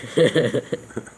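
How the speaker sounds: high loud burst of laughter. Real, not acted. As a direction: crack up at the garden hose. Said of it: "Hose swearing".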